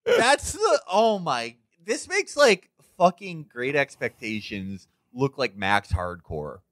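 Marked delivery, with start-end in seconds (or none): 0.0-1.5 slow, high mocking swoon; dreamy mock repeat. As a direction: drag it out like a swooning fan. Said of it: "mock repeating the text".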